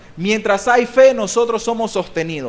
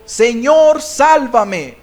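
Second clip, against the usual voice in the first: loud, raised plea for rescue; crying out; 3 semitones higher, 6 dB louder, much slower than usual. Acted. Peter starting to sink and calling to Jesus, crying out. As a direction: cry it out to the Lord. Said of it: "dio voces diciendo".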